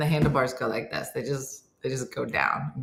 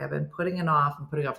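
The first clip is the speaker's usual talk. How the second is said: ordinary delivery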